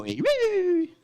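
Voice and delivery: squealing imitation of a police siren. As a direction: wail it like a siren. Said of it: "And then the police go".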